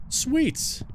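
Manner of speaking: very high, excited "sweet"; excited squeal. Real, not acted. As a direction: squeak it out in delight. Got the bone.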